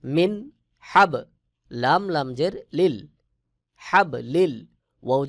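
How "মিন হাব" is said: মিন হাব with the nun said clearly before হা; clear izhar. Shown as a demonstration. Teacher models মিন হাব for students, nun distinct. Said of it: "স্পষ্ট করে পড়তে হবে".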